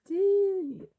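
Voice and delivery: loud falsetto shout of a name; high-pitched cry. Acted. Lector crying out to Sting, high-pitched cry. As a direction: scream to your friend, very high.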